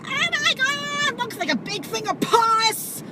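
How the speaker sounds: high, slow squeal of disgust; grossed-out neighbor. Retold or imitated. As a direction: squeal at the pus. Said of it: "She's like".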